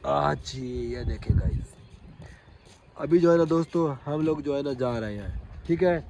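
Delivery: ordinary delivery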